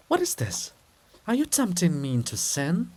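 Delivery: shocked and scandalised at his plea; startled. Acted. Olha startled by Andrew's plea, shocked. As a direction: voice jumps up, shocked.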